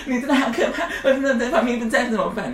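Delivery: high-pitched, frightened friend; scared coworker. Retold or imitated. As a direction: squeak it out like a timid coworker. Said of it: "我那个同事在旁边".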